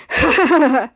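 short string of staged ha-has; acted chuckle. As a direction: imitate a hero's self-pleased laugh. Retold hero's laugh.

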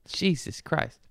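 usual speaking voice

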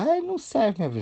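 squeaky high complaint of a client; whiny client. Retold or imitated. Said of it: "Aí ela fala".

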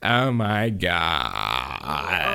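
very slow, low creaky drawl; exaggerated vocal fry. Shown as a demonstration. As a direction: stretch it out into a long croak. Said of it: "when you talk like this".

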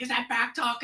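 angry coach. Retold or imitated. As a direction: call out the player's insolence. Angry coach yelling at player.

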